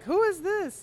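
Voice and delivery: surprised. Retold or imitated. Retold puzzled reaction to email.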